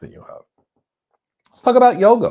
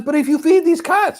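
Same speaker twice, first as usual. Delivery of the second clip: much higher, louder mock shrieking neighbor; imitated scream; 8 dB louder than usual. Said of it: "and she's screaming".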